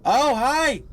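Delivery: loud startled oh hi. Real, not acted. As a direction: jump at the sudden face. Surprised by little girl.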